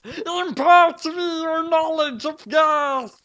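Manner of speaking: high shrieking demand; screaming. A narrator's reading. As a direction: demand it like a frantic nerd. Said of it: "Nier screamed".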